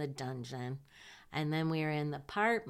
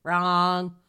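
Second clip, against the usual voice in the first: ordinary talk, nothing marked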